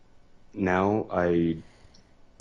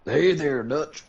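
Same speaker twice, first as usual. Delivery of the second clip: higher, louder mock cowboy greeting; Arthur Morgan voice; 9 semitones higher, 4 dB louder, slower than usual. Shown as a demonstration. Friend answers with his own Arthur Morgan try. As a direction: throw out a rough outlaw hello. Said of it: "let's hear yours".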